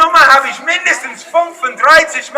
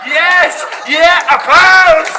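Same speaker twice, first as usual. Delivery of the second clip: loud, higher showman's call; calling for applause; 5 semitones higher, 3 dB louder than usual. Real, not acted. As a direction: whip up the crowd loudly. Calls crowd to applaud.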